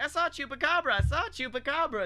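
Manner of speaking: high, excited insistence; insistent young witness. Acted. Boy insisting to doubters, high-pitched and urgent. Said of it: "He's like".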